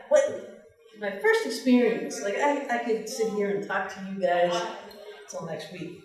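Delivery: ordinary talk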